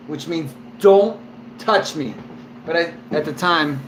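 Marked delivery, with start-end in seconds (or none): 0.8-2.1 slow, spaced-out warning, slightly raised; firm warning. Retold or imitated. Drunk prisoner to grabbing cellmate, slow firm warning. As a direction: separate the words to warn him off. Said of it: "at the time I said it, like, in that tone".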